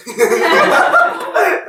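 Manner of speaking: high stifled chuckle; put-on snicker. King watches his officials quarrel, mock snickering. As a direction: snicker from the sidelines.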